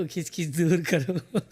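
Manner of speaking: low, slow hammed-up ad voice; mock ad slogan. Whisky ad slogan parody. (જાહેરાત જેવો ધીમો ઊંડો અવાજ)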